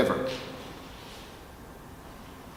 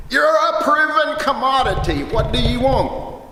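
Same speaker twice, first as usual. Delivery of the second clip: much higher, louder exasperated shout; exasperated yell; 12 semitones higher, 5 dB louder than usual. Retold or imitated. Record executives yelling at a departing producer, exasperated shout. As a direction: fling the question back in frustration. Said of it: "I heard a bunch of expletives".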